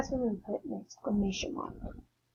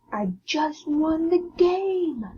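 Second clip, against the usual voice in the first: much higher, louder, slow triumphant cry; super excited; 7 semitones higher, 6 dB louder, much slower than usual. Shown as a demonstration. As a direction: crow about the win. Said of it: "super excited".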